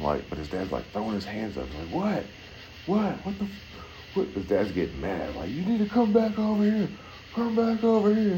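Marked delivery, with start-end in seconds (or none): 1.9-4.3 high baffled what; confused dad. Retold or imitated. Dad on the shore yelling at his son, high and baffled. 5.5-8.4 put-on a father ordering his kid back; angry dad calling. Dad shouting at his drifting son, high and stern.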